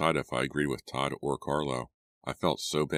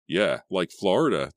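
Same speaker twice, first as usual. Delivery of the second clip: louder, higher joking retort; 4 semitones higher, 6 dB louder than usual. A narrator's reading. Todd joking with freezing friends about a warm place, joking. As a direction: toss it out as a wisecrack. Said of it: "Todd joked".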